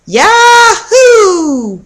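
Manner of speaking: a high, loud whoop with a laugh in it; joyful shout. A narrator's reading. Russell whooping as he chases his sister, loud and laughing. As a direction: whoop it out while running through empty rooms. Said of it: "Russell called as he ran".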